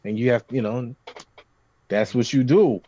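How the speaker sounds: ordinary talk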